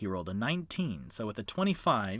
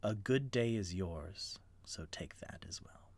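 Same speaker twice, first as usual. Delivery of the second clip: hushed fey farewell; 8 dB quieter than usual. Acted. Fey's parting whisper.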